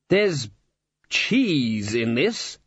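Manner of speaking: very drawn-out, affronted words; accusing. A narrator's reading. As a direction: stretch it out as an accusation. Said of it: "he said accusingly".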